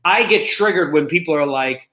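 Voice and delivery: ordinary talk, nothing marked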